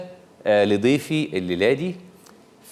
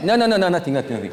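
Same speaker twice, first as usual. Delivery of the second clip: flustered host denying any insult; flustered denial; 8 semitones higher than usual. Real, not acted. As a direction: deny it in a flustered rush. Host denies insulting accent.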